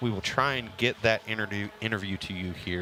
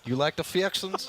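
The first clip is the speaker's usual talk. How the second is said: teasing; 7 semitones higher than usual. Pretend. Teasing the word fixins.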